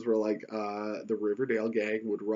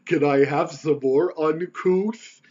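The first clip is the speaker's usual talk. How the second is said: slow, louder dim-witted drawl; dopey Moose voice; 4 semitones higher, 9 dB louder, slower than usual. Retold or imitated. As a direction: play the dopey big guy asking for seconds. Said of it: "he passes the empty plate over and goes".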